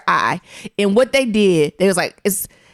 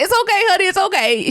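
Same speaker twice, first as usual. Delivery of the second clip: much higher sweet mock nurse voice; soothing nurse; 11 semitones higher than usual. Nurse reassuring the frightened patient, in a much higher cooing voice. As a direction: coo it like a kindly nurse. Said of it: "she's like".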